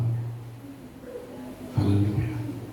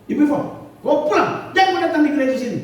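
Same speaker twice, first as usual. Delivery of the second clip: mock eviction; 11 semitones higher, faster than usual. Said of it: "saya usir sama Ibu".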